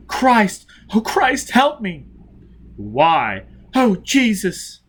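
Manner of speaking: high, loud cries for help; anguished praying. A narrator's reading. Tortured man cries to God under questioning, anguished. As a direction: pray in terror.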